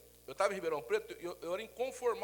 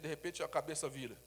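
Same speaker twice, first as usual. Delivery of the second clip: ordinary talk, nothing marked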